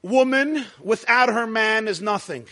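"without her man" kept together as one phrase; "Woman", then "without her man is nothing" in one run; first reading, unbroken phrase. Shown as a demonstration. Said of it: "Listen to this sentence".

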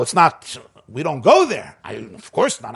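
normal talking voice

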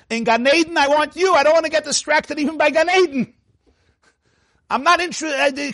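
high-pitched, impassioned insistence; fervent plea. Acted. Teacher voicing a Rebbe's prayer to God, fervent plea. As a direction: plead it high, refusing every distraction.

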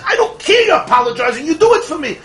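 demanding customer. Retold or imitated. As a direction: insist she do it, voice climbing. Customer demands teller act.

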